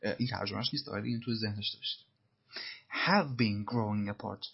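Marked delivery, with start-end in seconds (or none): 3.1-4.5 "have" said slow and leaned on; stress on have. Shown as a demonstration. Teacher showing students the stress on "have". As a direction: press the word "have", slow and clear. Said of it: "تأکیدش روی have".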